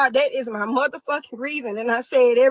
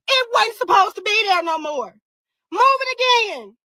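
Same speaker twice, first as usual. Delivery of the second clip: crying shout; 6 semitones higher, 3 dB louder than usual. Real, not acted. Crying out over court moves.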